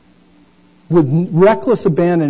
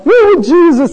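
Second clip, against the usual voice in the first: higher, louder bragging; higher proud mock announcement; boastful disciple; 11 semitones higher, 7 dB louder than usual. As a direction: show off loudly to the crowd. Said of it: "you know".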